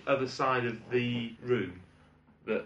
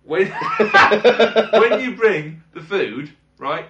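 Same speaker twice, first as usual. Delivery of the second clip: laughing rant; 5 semitones higher, 10 dB louder than usual. Real, not acted. Ranting instructions at waiters.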